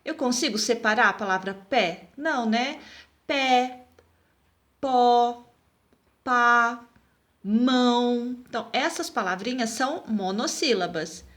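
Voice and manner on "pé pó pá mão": pé, pó, pá, mão, each set apart; one word at a time, long pauses between; single-syllable examples. Shown as a demonstration. Teacher giving one-syllable examples, evenly spaced. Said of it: "palavra que tem uma sílaba".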